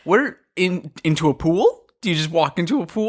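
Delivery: high, baffled string of questions; incredulous. Real, not acted. Co-host asking where the plank leads, high and incredulous. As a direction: puzzle it out in comic disbelief.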